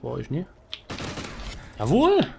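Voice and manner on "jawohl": high, sharp victory cry; triumphant cheer. Real, not acted. Streamer cheering another kill, high triumphant cry.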